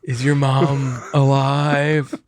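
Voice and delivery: slow, drawn-out comic drone; mock boss voice. Co-host imagining a call to the old boss, slow mock drone. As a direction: ask it very slowly in the borrowed drone.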